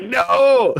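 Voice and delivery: high-pitched hammed-up wail; mock horrified cry. Teasing a friend with his imagined reaction, mock horror. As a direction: cry out in pretend dismay.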